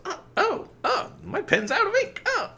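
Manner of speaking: high-pitched mock exasperation; exasperated. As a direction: imitate a woman making a show of being put out. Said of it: "and she, exasperated, goes".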